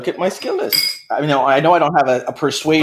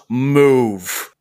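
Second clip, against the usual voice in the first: penetrating command. Acted. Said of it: "a very penetrating voice just goes".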